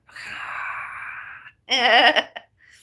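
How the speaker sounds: big loud laugh breaking out; sudden loud cackle; loud burst of laughter. Real, not acted. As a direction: laugh out hard, almost crying. Laughs after rainbow joke.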